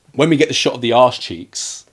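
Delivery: normal talking voice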